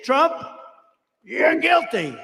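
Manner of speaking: high, loud mocking pronouncement; mock loud verdict. Mimicking judges ruling against him, loud and mocking. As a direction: ham up the guilty verdict. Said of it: "If they say".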